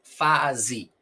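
"Fase" as FA-zi, falling; final I. Shown as a demonstration. Teacher demonstrating "Fase" with E said as I. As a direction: end "Fase" on I, with a Z. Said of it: "nosotros pronunciamos I".

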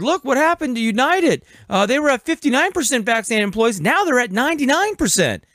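high, boastful mock quote; imitating Biden. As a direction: mimic a bragging president. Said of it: "he said".

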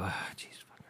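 whispered curse to himself; under-breath whisper. Retold or imitated. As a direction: breathe it out unvoiced, annoyed. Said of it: "I go".

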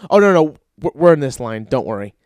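brushing off with a loud cheery voice; loud, breezy reassurance to a stranger; mock guy in line. Guy in line waves off the comedian, loud and breezy.

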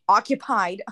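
loud put-on mom call; imitating mom. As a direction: call out loudly, as mom through a stall door. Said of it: "I remember Mom, like, being like".

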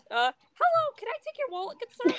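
very high squeaky cartoon mouse voice, a laugh breaking into the middle; Mickey Mouse impression. Friends chatting, impression of Mickey Mouse asking for a wallet in a high cartoon voice. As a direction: pitch way up into a mock Mickey squeak. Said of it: "a mediocre Mickey Mouse impression".

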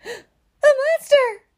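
high, drawn-out cry of alarm; loud, panicky wail; frightened shout. A narrator's reading. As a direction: shriek it slowly in storybook terror. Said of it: "shouted Baby".

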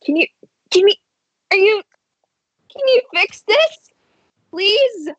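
very high squeaky begging voice; a frantic creature begging for help; desperate pleading. Acted. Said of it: "He goes".

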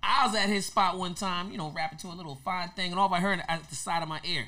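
ordinary delivery

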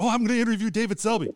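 high, fast, thrilled gushing; eager rush of excitement; excited. Retold or imitated. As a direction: rattle it off like a starstruck fan. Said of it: "It's like".

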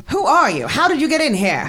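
startled, indignant questioning; alarmed demand. Acted. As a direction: demand answers, pitch jumping up. Startled writer challenges an intruder.

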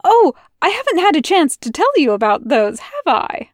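Aziraphale bursting with eager news, pitch up; high and eager, can't wait to share; excited. Acted. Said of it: "he spoke excitedly".

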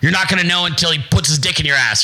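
normal talking voice

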